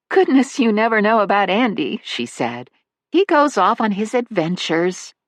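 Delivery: high, light motherly voice; a fond older mother chatting about her son; elderly mother. Acted. Narrator voices Missus Manley.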